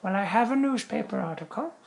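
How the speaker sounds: put-on high frail old woman voice; high reedy old lady voice. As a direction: play the withered old nun. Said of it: "that's my old woman voice".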